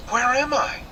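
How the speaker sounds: high, wondering question; bewildered girl just waking. Acted.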